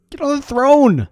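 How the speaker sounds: usual speaking voice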